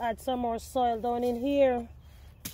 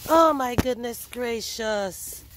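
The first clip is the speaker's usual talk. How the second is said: usual speaking voice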